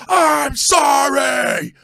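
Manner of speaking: slow, loud, high mock-furious shout of "sorry"; hammed-up angry fan yelling sorry; angry apology. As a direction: spit the apology out angrily while cracking up. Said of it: "it was just the same anger".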